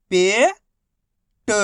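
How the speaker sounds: each syllable held, long pause between; first syllable rising, then a separate second. Shown as a demonstration. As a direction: sound it out for beginning readers. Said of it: "एक ही अक्षर का उच्चारण करके".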